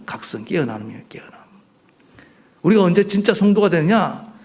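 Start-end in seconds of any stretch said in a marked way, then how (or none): 0.0-1.3 hushed whisper. Real, not acted. Preacher to congregation about awakening, whispering. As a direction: breathe the word out softly. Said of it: "whispered emphasis in sermon".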